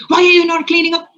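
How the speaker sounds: frustrated scolding. Retold or imitated. Mother scolding her children over toys, frustrated and quick. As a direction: snap the question like a tired parent. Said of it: "I feel very frustrated".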